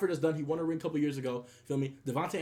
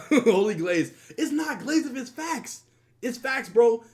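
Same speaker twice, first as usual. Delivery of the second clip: laughing it off, a little louder; grinning through the words while laughing; 6 semitones higher, 6 dB louder, slower than usual. Real, not acted. Laughing while being teased.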